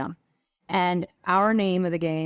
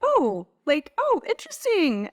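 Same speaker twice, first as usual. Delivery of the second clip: lightly voiced, higher, teasing dig; playful mock reaction; 12 semitones higher than usual. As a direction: tease them with a mock-surprised jab. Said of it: "a playful way to be like".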